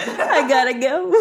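loud, slow, helpless laughter; laughing exit line. Real, not acted. Co-host joking about being deported, laughing. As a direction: barely get it out for laughing. Cracking up after deportation joke.

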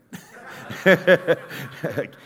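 a real, high, loud laugh at the memory; loud burst of laughter. Real, not acted. Speaker laughing at his dad's reply, loud real laugh.

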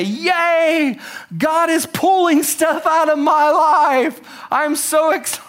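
high, slow mock-excited yay; hammed-up cheer; sarcastic cheer. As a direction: fake delight, dripping irony.

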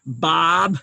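Boston accent on Bob, vowel drawn out. Shown as a demonstration. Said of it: "when you say it with the Boston accent".

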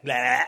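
short, loud "blah" noise; loud mock roar. Excited angel startling the soldiers, loud mock roar. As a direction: blurt a sudden noise to scare.

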